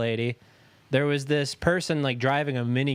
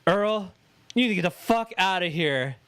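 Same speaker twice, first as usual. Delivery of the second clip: startled yell; 6 semitones higher than usual. Retold or imitated. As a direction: yell it, roused from sleep. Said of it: "I'm like".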